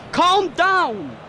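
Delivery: high, loud shout to himself; loud self-command. Real, not acted. Streamer shouting at himself to stay calm, loud. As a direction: yell at yourself to chill. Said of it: "What did I say to start the stream?".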